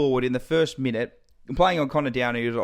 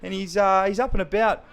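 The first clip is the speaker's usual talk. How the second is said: excited commentary; 7 semitones higher than usual. Real, not acted. Excited commentary on opponent's goal.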